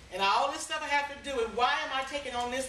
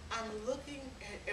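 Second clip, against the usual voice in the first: softer mock-theatrical sigh of the name; dramatic sigh; 11 dB quieter than usual. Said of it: "She's so dramatic".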